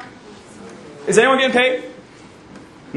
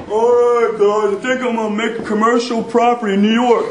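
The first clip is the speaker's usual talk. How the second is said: Donald Trump impression. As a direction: breezily announce a big deal like a casual mogul. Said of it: "have you ever seen Donald Trump roll up like".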